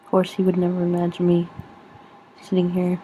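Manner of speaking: usual speaking voice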